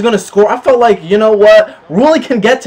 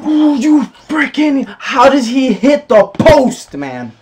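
frustrated outburst; 6 semitones higher, slower than usual. Real, not acted. Shot hits the post.

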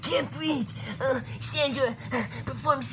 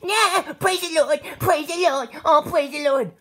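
excited praise; 3 semitones higher, 7 dB louder than usual. Acted. Churchgoer loudly cheering the preacher's story. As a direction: shout praise from the pews.

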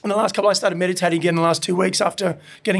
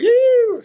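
high loud squeal of a buzz; a loud, high whiskey-buzz squeal; buzzy squeal; 6 dB louder than usual. Retold or imitated. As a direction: let out a high excited squeal. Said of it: "I'm like buzzing".

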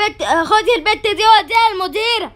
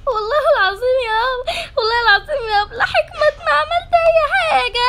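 high, sobbing plea of innocence; crying denial; 4 semitones higher than usual. Acted. Accused schoolgirl sobbing to her teacher. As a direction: beg through tears that you did nothing.

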